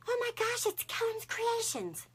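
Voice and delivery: high-pitched mock fan squeal; imitated excited yell. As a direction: imitate a thrilled fan, voice way up, drawing out the name. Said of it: "this lady yells out".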